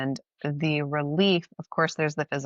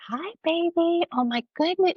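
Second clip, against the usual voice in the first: imitating toddler's baby voice; 13 semitones higher than usual. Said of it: "she starts talking in a baby voice".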